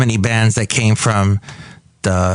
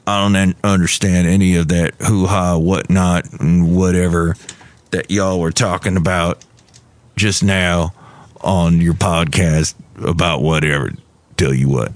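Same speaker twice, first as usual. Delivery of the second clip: put-on much lower, grumbling old-timer voice; cranky fiddler grumbling at a podcast; disgruntled fiddle player; 6 semitones lower than usual. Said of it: "disgruntled fiddle player".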